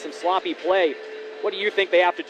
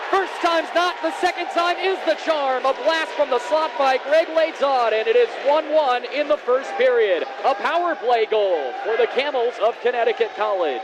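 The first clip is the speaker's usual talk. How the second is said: excited goal call; 6 semitones higher than usual. Real, not acted. Second goal confirmed, still calling.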